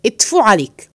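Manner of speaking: spat out in contempt; disgusted spit. Acted. Plotter spits disgust at his cleaning friend. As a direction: spit the words at him.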